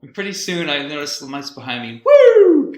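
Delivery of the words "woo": high, loud siren imitation whoop; mock siren whoop. Telling friend the cops pulled him over, loud siren whoop. Police lights appear behind.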